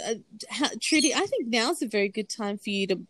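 normal talking voice

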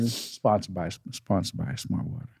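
quiet unvoiced tag line; hushed aside. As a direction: whisper the sponsor mention. Joking fake sponsor aside.

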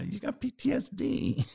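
high, matter-of-fact diagnosis; therapist voice. Retold or imitated. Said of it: "And she said".

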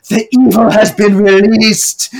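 imitating a yelling old woman, slow and high; mock yell. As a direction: boom out a doom-laden warning. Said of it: "Lucille then yells".

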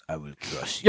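low menacing Russian boxer voice; Ivan Drago. Retold or imitated. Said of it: "Ivan Drago wear that hat".

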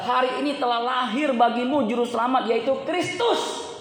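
loud, high verse reading that keeps rising; proclaiming scripture. Real, not acted. Preacher reads the birth announcement to congregation, loud proclamation.

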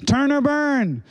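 lightly voiced angry revival preacher. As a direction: ham up a pulpit-pounding preacher. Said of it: "angry preacher slamming a pulpit".

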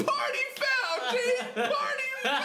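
very high, slow, laughing mock scold; scolding dad. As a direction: stretch it out like the disgusted dad. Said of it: "He was like".

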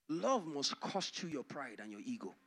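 normal talking voice

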